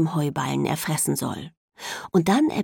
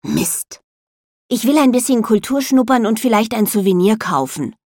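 hissing snap; 5 semitones higher, 7 dB louder than usual. A narrator's reading. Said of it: "fauche ich".

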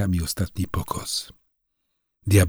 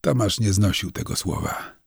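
usual speaking voice

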